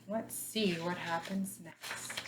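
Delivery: ordinary delivery, nothing marked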